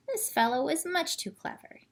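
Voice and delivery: plain reading voice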